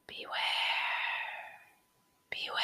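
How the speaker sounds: soft, breathy ghostly whisper; wind whispering. A narrator's reading. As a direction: whisper it like the wind in a dark wood. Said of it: "the wind seemed to whisper".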